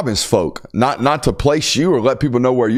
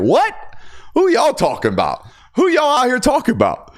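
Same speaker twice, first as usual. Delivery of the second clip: who are they even talking about, much higher; incredulous; 12 semitones higher than usual. Real, not acted. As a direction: pitch jumps way up in disbelief. Incredulous at critics' claims.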